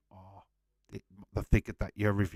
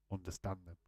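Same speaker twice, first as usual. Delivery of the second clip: whispered; 2 semitones lower, 13 dB quieter, faster than usual. Retold or imitated. Reading a viewer's comment.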